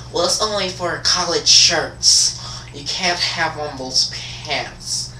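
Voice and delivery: slow, drawn-out mock explaining; put-on administrator voice. Administrator explaining shirt rule to student, slow mocking imitation. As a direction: stretch each phrase out in a fussy imitation.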